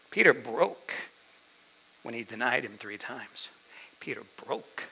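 normal talking voice

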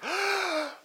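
exaggerated sucking inhale; big demonstrative gasp for air; loud gasp. Shown as a demonstration. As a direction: gulp air after running out of breath. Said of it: "suck in a breath of air".

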